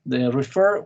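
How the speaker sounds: ordinary delivery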